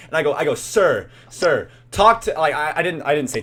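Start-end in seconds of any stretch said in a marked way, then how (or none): 0.5-2.3 loud, high, insistent interjection; imitated attention-grabbing. Teen pulling an angry man off mom, insistent. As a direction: cut in to draw his attention. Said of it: "I was trying to, like, get him, like, the attention on me".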